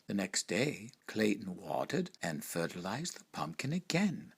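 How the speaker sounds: ordinary delivery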